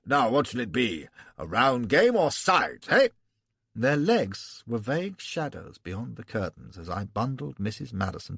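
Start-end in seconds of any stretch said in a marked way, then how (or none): 0.0-3.1 hearty Irish brogue, general at billiards; broad Irish accent, jovial. Acted. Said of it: "Gough in his pigsty brogue".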